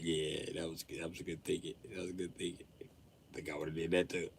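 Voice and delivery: humoring. Retold or imitated. Humoring the kid.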